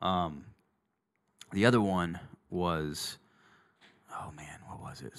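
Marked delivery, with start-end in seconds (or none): 4.1-5.2 whispered aside to self. Real, not acted. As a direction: whisper it under your breath. Trying to remember, aside.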